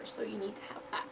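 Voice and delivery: soft, fast whisper; whispered aside. Real, not acted. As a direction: toss it off under your breath. Aside during craft instructions.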